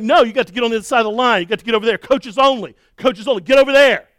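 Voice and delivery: hammed-up race marshal yelling; yelling orders. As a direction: clear the finish line by shouting. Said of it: "I'm, like, yelling at people".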